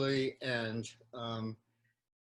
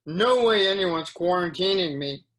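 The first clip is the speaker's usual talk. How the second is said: put-on raised, higher voice for a yelling teen; mild poetic yell, louder and higher; teen girl's yell; 6 semitones higher, 6 dB louder than usual. A narrator's reading. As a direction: read it as a restrained yell of defiance. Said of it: "A teen girl yells".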